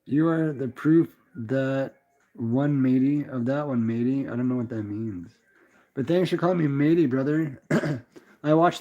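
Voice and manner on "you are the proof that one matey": usual speaking voice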